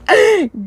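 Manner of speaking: a sudden high burst of laughter; real laugh. Real, not acted. The reader laughing at her own reading mistake, a real giggle. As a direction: break into a real giggle at the misreading. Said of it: "did I really read that as wolves".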